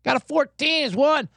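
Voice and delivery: ordinary delivery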